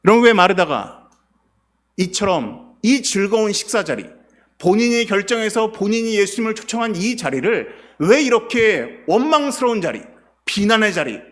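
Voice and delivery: preacher calling out to the congregation, loud and urgent; fervent preaching. Acted. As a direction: press the congregation with rising urgency. Preaching at full force.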